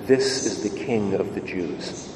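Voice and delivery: solemn, hushed reading of the inscription; inscription read quietly. Acted. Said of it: "an inscription that read".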